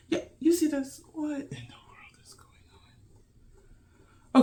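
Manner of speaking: hushed, breathy conspiratorial whisper; whispered aside. Real, not acted. Vlogger teases coming shenanigans, whispering. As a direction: lean in and whisper the secret.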